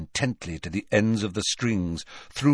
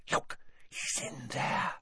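slow, voiceless whisper; breathed whisper; 13 dB quieter than usual. A narrator's reading. Hermione in Harry's ear outside the shop, whispering. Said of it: "she breathed in Harry's ear".

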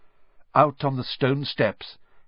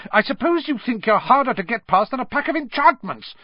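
higher and louder, scolding hard; furious teacher telling off students; storming; 14 semitones higher, 5 dB louder than usual. A narrator's reading. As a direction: tear into the boys. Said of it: "she stormed".

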